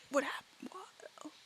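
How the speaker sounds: slow, stunned whisper; confused. Acted. Pontius stares at the dragon, confused whisper. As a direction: breathe it out haltingly, baffled. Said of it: "in confusion".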